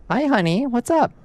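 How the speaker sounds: high sing-song pet voice; high cooing hello to a pet. Real, not acted. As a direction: coo at the cat. Greeting the cat sweetly.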